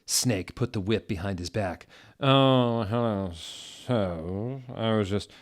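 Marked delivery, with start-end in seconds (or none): 2.2-5.3 slow, drawn-out innocent act; hammed-up guilty Snape; Snape voice lying. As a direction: fake innocence as the sneering teacher. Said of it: "he lied".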